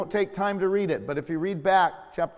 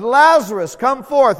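loud command; commanding call; 6 semitones higher, 10 dB louder than usual. Acted. Preacher repeating Jesus' call, loud and commanding. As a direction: call out with authority.

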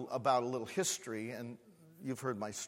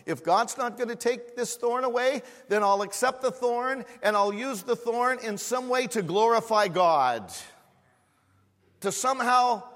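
high, loud preaching swell; fervent preacher's climax; preaching at full force; 9 semitones higher, 9 dB louder than usual. Acted. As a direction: lift the whole church with it.

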